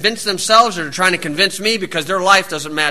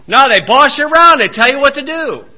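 ordinary talk

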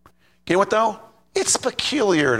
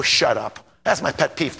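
normal talking voice

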